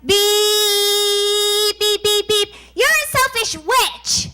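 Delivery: imitated car horn, then high, drawn-out insult; honking driver yelling. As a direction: mimic a long beep, then a high slow jeer. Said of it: "and I hear".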